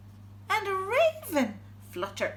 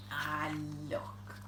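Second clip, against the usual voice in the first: whispering; 12 semitones lower, 8 dB quieter than usual. Real, not acted. Quiet moment, reading to boys.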